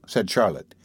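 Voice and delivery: ordinary delivery, nothing marked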